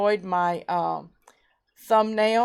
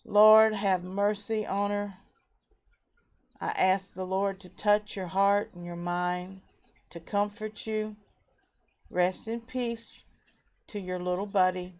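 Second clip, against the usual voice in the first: softer, gentle praying voice; tender prayer; 4 dB quieter than usual. Real, not acted. Host praying for a viewer whose hamster died, soft and tender. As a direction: comfort her quietly as you pray.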